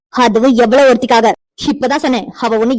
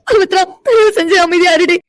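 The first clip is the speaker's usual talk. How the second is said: higher, crying through the words; weeping; 6 semitones higher than usual. Acted. Amirtha cries to her friend, blaming herself. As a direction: sob out the self-blame.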